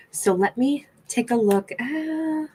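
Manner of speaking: whispering. Real, not acted. Looking at the cards.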